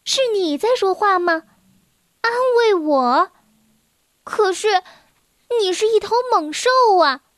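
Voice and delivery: put-on a girl amazed that the beast talks; surprised little girl. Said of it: "米奴斯很惊讶".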